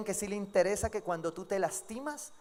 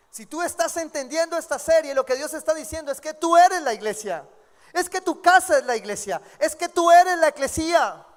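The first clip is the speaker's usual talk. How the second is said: louder, higher preaching; full-force preaching; 6 semitones higher, 9 dB louder than usual. Acted. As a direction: drive the point home to the church. (predicación con fuerza)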